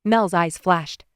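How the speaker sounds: ordinary delivery, nothing marked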